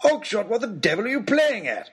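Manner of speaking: high-pitched lordly outrage; exasperated aristocrat calling out; indignant demand. A narrator's reading. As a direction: demand an explanation from a fool. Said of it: "demanded Lord Daunt".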